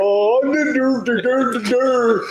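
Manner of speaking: squeaky mocking impression of him; wife's impression of husband. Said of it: "what you and I sound like when our wives impersonate us".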